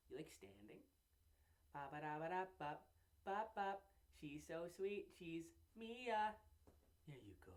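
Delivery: soft, hushed cooing to a pet. Real, not acted. Cooing softly to his cat Mia in baby talk. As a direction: coo gently at the kitten.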